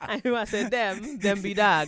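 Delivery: loud words pushed out through laughter; real laughter shaking the words; laughing. Real, not acted. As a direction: say it while cracking up.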